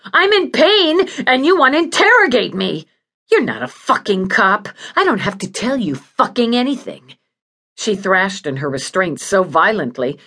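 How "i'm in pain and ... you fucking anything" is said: high-pitched, furious outburst of pain; indignant patient yelling at an interrogator; screamed. Acted. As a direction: cry out, then rail angrily at the questioner. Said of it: "Judy screamed".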